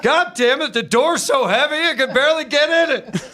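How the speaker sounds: put-on high, exasperated old-man grumble; old angry guy. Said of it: "let's go to the old angry guy".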